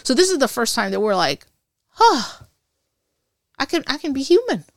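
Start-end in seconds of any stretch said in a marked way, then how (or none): 1.9-4.6 mock amazed relief. Relief after silent basic training.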